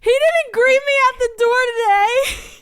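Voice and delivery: very high hammed-up tearful whine; exaggerated near-crying complaint; mess on the phone. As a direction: wail it like a mock sob. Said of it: "I was a mess on the phone".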